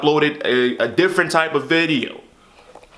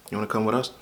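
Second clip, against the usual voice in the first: softer, gentle imitated invitation; sweet tone; 6 semitones lower, 7 dB quieter, much faster than usual. Officer gently inviting Marcus to come with them, softer and sweet. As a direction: ask it sweetly, like offering a favor. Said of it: "he said it in the sweet tone".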